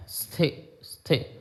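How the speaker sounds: स्थे with a breathy half स, no vowel before it; half sa sound. Shown as a demonstration. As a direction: say स्थे with the स barely voiced, no इ. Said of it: "आधा सा का उच्चारण भी नहीं आता". (स्थे में स आधा, हवा में)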